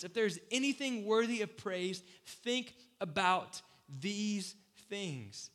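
rising, emphatic scripture read that slows at the end; solemn scripture read, slowing on think about these things. Acted. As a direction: read the verse like a building proclamation. Reads scripture aloud.

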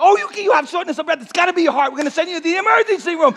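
high-pitched, urgent mock diagnosis; alarmed doctor. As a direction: imitate a doctor jumping to the worst conclusion. Doctor's alarmed verdict, retold.

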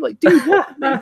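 high, slow disbelieving "what"; incredulous. Real, not acted. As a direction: stretch it out in disbelief. Disbelief at friend's rating.

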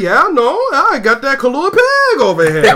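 playful Hawaiian sing-song ending high; imitating a local answering "yeah, no" with a Hawaiian lilt. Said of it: "they go real high at the end of the sentence".

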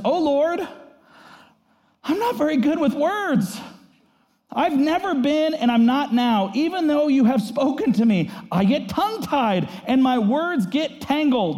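voiced up high as a reluctant, whining Moses; pleading Moses. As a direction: plead like a man who can't speak well. Moses pleading, quoted from verse ten.

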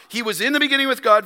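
He was in the beginning with God rushed out fast; quick repeat for emphasis. Shown as a demonstration. Preacher repeating John's words, fast. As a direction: rattle it off quickly the second time. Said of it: "John repeats this for emphasis".

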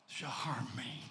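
breathy hushed whisper. Acted. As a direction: drop it to a whisper. Hushed end of declaration.

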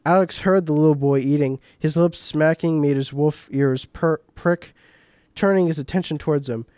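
plain reading voice

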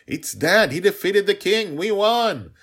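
put-on very high, loud triumphant child; thrilled cheer. A narrator's reading. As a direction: celebrate the victory at full voice. Girl celebrates father's win.

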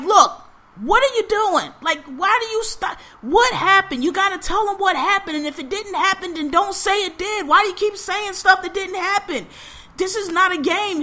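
high-pitched exasperated scolding; fed-up mom. Retold or imitated. Mom scolding son over changing stories, fed up. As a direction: imitate a mother who has had enough. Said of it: "even she got fed up".

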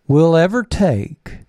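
slow, mocking imitation of a senator's slight twang; Mitt Romney twang. Host mimicking the senator's speech, slow mock twang. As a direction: drag it out, parroting his faint twang. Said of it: "That little twang of an accent".

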